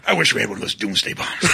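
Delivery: lightly voiced low movie-character line; George C. Scott. Quoting a favorite film to podcast friends, light George C. Scott impression. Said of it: "George C. Scott deserved an Oscar".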